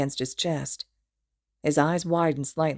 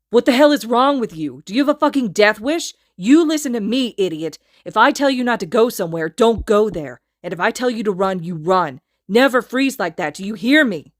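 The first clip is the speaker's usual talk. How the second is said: loud, higher enraged tirade; furious yelling; 5 semitones higher, 8 dB louder than usual. A narrator's reading. Kacchan scolds Izuku nose to nose, furious. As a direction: tear into him.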